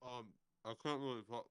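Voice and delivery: talking around a mouthful of sandwich; words muffled by a mouth full of food. Real, not acted. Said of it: "Don't speak with your mouth full".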